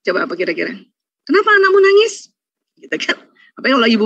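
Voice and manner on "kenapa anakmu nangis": higher and a bit louder, mock-accusing; accusing mother-in-law. Mother-in-law scolding daughter-in-law over crying baby, mock accusing. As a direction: needle her about the crying baby. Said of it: "Ibu mertua komennya apa coba?".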